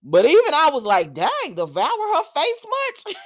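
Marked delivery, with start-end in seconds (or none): 1.1-3.1 high, drawn-out disbelief breaking into a laugh; amused mock shock. Viewer watching a long screen kiss, amused shock. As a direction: squeal it, scandalized and amused.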